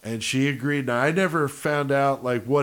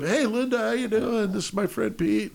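much higher, breezy friend introducing; friendly greeting; 10 semitones higher than usual. Retold or imitated. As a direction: chirp it like a buddy saying hi. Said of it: "He walks up".

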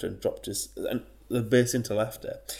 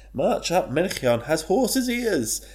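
mocking reed voice; 8 semitones higher, 5 dB louder, slower than usual. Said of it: "words come out of the reed saying".

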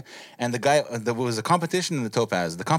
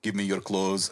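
low, soft Terminator attempt; tentative Austrian action-hero voice; lightly voiced Austrian tough guy; Arnold; 7 semitones lower, 5 dB quieter, slower than usual. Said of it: "what's your Arnold impression".

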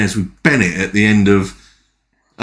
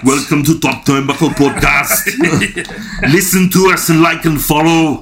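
mock Arnold podcast intro, higher; Schwarzenegger impression; 5 semitones higher than usual. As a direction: announce the show as a hammed-up Arnold.

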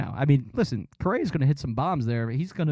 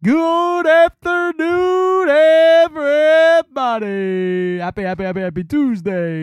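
high, loud, slow sing-song radio greeting; Gargano impression; 10 semitones higher, 8 dB louder, slower than usual. Doing Gargano greeting listeners, high, loud and drawn out. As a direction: stretch out the hello like a radio host. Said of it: "you don't like the Gargano one".

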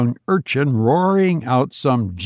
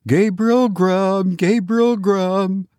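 high, drawn-out eerie chant; wild screaming chorus; 7 semitones higher, slower than usual. A narrator's reading. Goblin voices filling the churchyard, chanting high. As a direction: wail the name slowly, pitched high. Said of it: "The invisible chorus replied".

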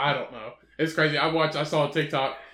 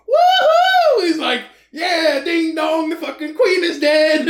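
high, loud whooping cheer; whooping celebration; 10 semitones higher, 8 dB louder, slower than usual. Retold or imitated. Retelling the paintballer's cheer, whooping loudly. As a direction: crow with glee.

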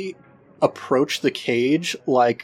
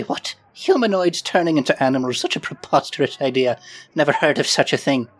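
incredulous character voice, scoffing at the idea; Fleeple's put-on voice. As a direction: put on a voice and dismiss it as preposterous. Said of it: "What happened to your voice just then?".

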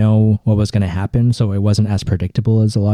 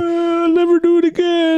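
mocking the father. Continuing father voice.

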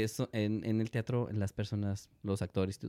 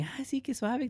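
impressed falsetto; 3 dB quieter than usual. Retold or imitated. Imitating impressed people.

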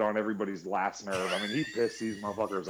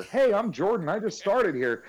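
higher, louder overeager greeting; imitating Jordan; 11 semitones higher, 6 dB louder than usual. Mimicking coworker's eager self-introduction, bright. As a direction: bounce in like a too-eager new hire. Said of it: "he just".

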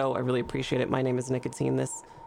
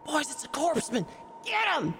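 high, shrill alarmed call; rally the gang, high pitched; excited thug shout; 10 semitones higher than usual. A narrator's reading. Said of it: "a voice start to go".

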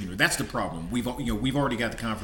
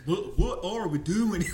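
slow, higher mock southern drawl; 8 semitones higher, slower than usual. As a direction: ham up a southern accent, drawn out. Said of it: "he's got a southern accent or a southern drawl".

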